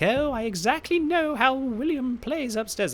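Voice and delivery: high, slow mock old British lady voice; old British woman. Imitating Tanya downstairs as an eighty-year-old British woman, high and slow. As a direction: put on a prim old English granny. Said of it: "not what she sounds like".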